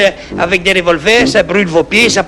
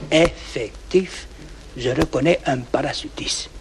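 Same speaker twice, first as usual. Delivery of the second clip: much softer, effectif stretched slowly; hushed reveal; 5 semitones lower, 11 dB quieter, slower than usual. Acted. Farmer spotting the parachutist, hushed reveal. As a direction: lean in and drop to a near hush.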